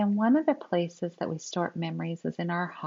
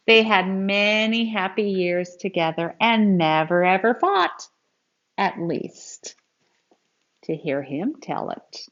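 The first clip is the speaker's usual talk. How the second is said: put-on lively, expressive read-aloud for kids; storybook reading; 4 dB louder than usual. Teacher reading a grandpa story to children, expressive read-aloud. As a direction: read it like a picture book to a class.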